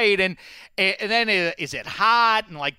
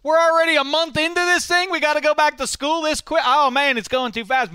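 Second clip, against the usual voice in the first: put-on whiny kid voice; 10 semitones higher than usual. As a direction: complain like a kid dreading school. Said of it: "you're like".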